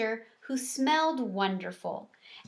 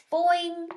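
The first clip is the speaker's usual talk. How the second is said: springy high boing; a bright hamster-story frog boing; twangy cartoon boing; 8 semitones higher, 5 dB louder than usual. A narrator's reading. Og the frog's sound.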